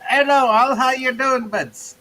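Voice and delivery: a cheerful mock hello in someone else's voice; voicing Itza. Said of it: "That's the totally wrong accent".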